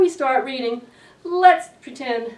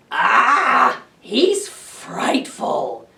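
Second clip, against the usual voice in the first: happy sigh; much slower than usual. Acted. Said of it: "sighed Mister and Missus Monster happily".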